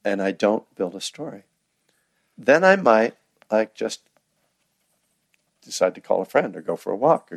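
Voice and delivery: ordinary delivery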